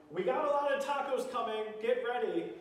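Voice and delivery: high, loud call down the line; imitated yell. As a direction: yell out an order to the kitchen crew. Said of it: "yells at the people on the line".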